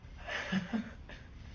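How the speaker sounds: quiet breathy laugh; a soft laugh slipping out; real laughter. Real, not acted. Podcaster about to give unfunny advice, laughing anyway. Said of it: "I don't know why I'm laughing".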